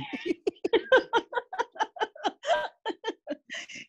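big high burst of laughter. Real, not acted. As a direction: crack up at the joke. Laughing at knee joke.

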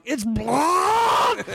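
high mock yell of blood; panicked yell. Tripping alone in the bathroom, yelling about the blood. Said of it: "I'm yelling".

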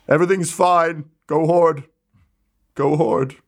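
high, hurried ringside patter; flustered arena announcer. Acted. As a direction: keep the crowd calm while panicking. Announcer scrambling on the mic.

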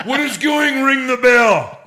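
loud, high laughing shout; laughing mock yell. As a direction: shout it while cracking up.

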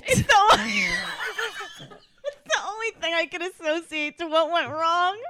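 high, laughing through the words; giggling as she confesses. Real, not acted.

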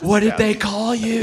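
playful startled cry; mock shocked shout. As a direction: blurt it out in disbelief. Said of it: "I was like".

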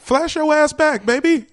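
high, loud playful slogan; loud hype shout. Acted. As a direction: yell it like a hype man. Ad slogan hyped.

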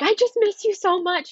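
high, loud voice of a crying dad; mock tearful dad on the phone. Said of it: "my dad called me crying".

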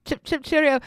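high, loud hammed-up Cockney accent; a silly high Cockney cheerio; mock Cockney. Co-host tops the Cockney bit, high and loud. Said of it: "ridiculous Cockney accent".

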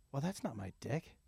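low, very soft puzzled aside; hushed realisation. Retold or imitated. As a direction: re-enact muttering it to yourself. Muttered realisation looking at photo.

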